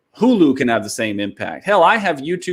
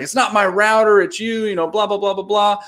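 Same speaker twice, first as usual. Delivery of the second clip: mock angry rant; 7 semitones higher than usual. Retelling angry customer rant.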